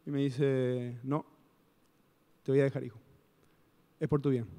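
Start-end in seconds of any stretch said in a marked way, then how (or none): none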